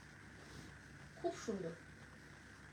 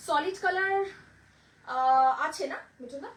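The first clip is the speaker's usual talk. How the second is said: loud, raised call to a helper; 2 semitones higher, 9 dB louder than usual. Real, not acted. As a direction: call across to your assistant with a question. Calling off to a helper.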